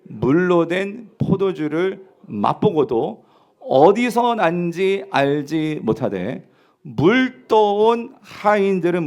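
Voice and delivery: very slow, measured scripture reading; reading aloud together. Real, not acted. Preacher reading the verse together with the congregation, slowly and deliberately. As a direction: lead the congregation word by word through the verse. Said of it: "같이 읽어볼게요".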